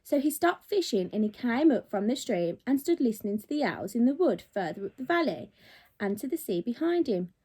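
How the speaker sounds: usual speaking voice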